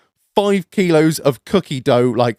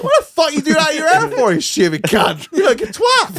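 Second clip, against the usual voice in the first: mock banter; 12 semitones higher than usual. Retold mock insult.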